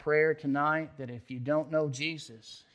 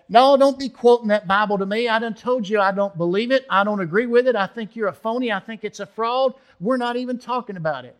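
loud, much higher, heated rant; angry refusal; 7 semitones higher, 10 dB louder than usual. Retold or imitated. Old Bert rejecting the preacher's verse, loud and heated. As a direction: shout him down, done with it.

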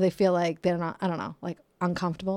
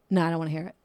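ordinary talk, nothing marked

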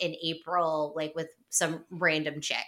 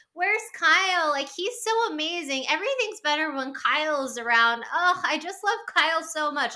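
much higher, hammed-up lovestruck gush; mock gushing wife; 8 semitones higher than usual. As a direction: swoon over him, overdone. Mocking Amanda gushing about Kyle.

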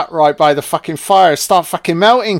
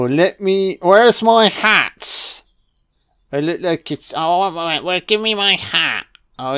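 snowman voice; 2 semitones lower, 3 dB quieter than usual. Pretend. Snowman admiring the Christmas house.

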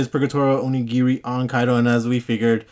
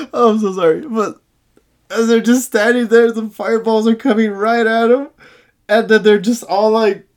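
laughing; 7 semitones higher, 5 dB louder than usual. Real, not acted. Laughing while retelling scene.

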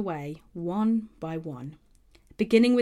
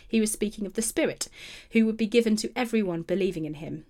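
ordinary delivery

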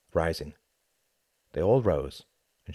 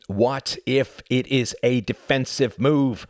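higher, louder and slow; demanding; 8 semitones higher, 7 dB louder, slower than usual. Acted. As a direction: press the question hard. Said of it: "demanded Cuthbert".

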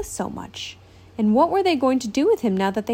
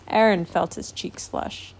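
ordinary delivery

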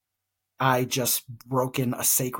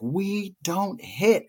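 higher-pitched, slow, each word drawn out; stern scolding; 7 semitones higher, much slower than usual. Retold or imitated. Aunt reprimanding her nephew for punching his brother, stern. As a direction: lay down the rule slowly and firmly.

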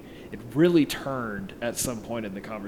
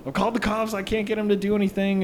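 frantic high explaining; 10 semitones higher, 3 dB louder than usual. Retold or imitated.

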